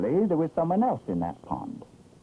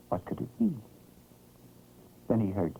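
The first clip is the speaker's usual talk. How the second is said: usual speaking voice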